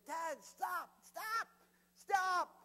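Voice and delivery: high, loud repeated cry; alarmed daughter. Retold or imitated. Daughter begging dad to stop laughing, high alarmed shouts. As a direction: yell it in mounting alarm, imitating a frightened child.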